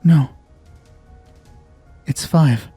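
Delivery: ordinary delivery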